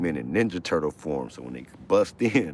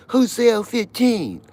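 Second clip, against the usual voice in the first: high, loud barking guard; mock guard yell; 10 dB louder, slower than usual. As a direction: bellow like a prison guard. Guard yelling in retold story.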